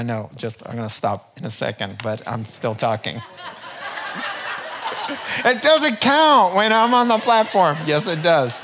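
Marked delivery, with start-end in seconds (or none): 3.3-5.4 breathless high unvoiced laugh; real laugh. Real, not acted. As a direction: laugh at yourself for running long. Laughs at his own rambling.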